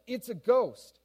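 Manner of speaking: startled, high, loud cry; fearful cry. A narrator's reading. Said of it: "he cried out in fear".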